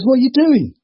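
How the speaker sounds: high, startled "what are you doing"; a dad walking in on something; shocked father. Acted. Father catches daughter in joke.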